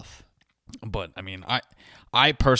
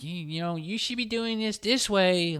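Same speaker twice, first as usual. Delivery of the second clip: pushy stranger; 8 semitones higher, 3 dB quieter than usual. Retold or imitated. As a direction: play the preachy stranger giving advice. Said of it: "some stranger come up to me and be like".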